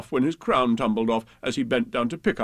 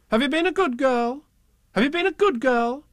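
high, sing-song rising question; high-pitched, insistent grown-up; questioning parents; 13 semitones higher, 4 dB louder than usual. A narrator's reading. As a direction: quiz a small child in a high parental coo. Parents voiced repeating their question.

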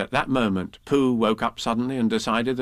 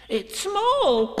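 very high squeal of discovery; piercingly high little piglet cry; excited cry. A narrator's reading. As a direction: squeak it out in amazement. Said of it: "cried Piglet".

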